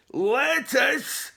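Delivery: loud, high disgusted question; outraged cry. A narrator's reading. Chief hears of lettuce sandwiches, outraged cry. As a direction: cry the word out in disbelief. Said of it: "cried Eric".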